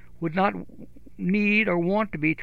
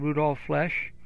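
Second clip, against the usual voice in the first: ordinary talk, nothing marked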